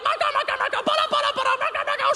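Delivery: rafale de cris aigus; comme une mitraillette. Retold or imitated. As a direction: mitrailler les mots très haut, en rafale. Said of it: "il est comme une mitraillette". (enfant anglophone qui tire les mots en rafale, aigu)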